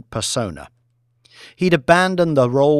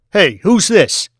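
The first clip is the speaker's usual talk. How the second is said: aggressive boss; 8 semitones higher, 8 dB louder than usual. Acted. Boss confronting new trader.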